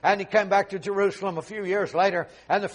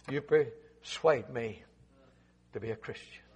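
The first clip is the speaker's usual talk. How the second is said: slow, much lower and much softer; hushed drop; 8 semitones lower, 10 dB quieter, slower than usual. Acted. Preacher quoting Agrippa, dropping to a slow hush. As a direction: let it fall to a near hush. Sudden hush after the shout.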